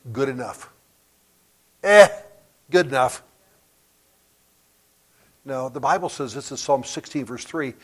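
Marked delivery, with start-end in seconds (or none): 1.8-3.2 a loud "Eh", then a careless verdict; dismissive shrug. Acted. Professor mocks a phrase he hates, dismissive shrug. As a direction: shrug it off as not worth more effort. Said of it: "I hate the phrase".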